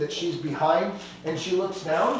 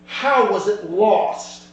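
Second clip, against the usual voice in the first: slow, stern, louder question; stern demand; 3 semitones higher, 6 dB louder, much slower than usual. Acted. Dragon lady demanding from Cedric how the shipment was lost, slow and stern. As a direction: bite down on each word like a boss losing patience. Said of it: "her voice gets very stern very quickly".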